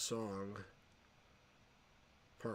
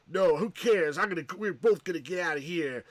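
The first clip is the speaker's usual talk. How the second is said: brash defiant retort; 5 semitones higher, 8 dB louder than usual. Retold or imitated. Kirk Douglas character brushing off.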